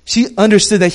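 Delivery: loud, high impassioned preaching; preaching at full force. Acted. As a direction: lift the room, full fervent sermon voice. Preaching crescendo.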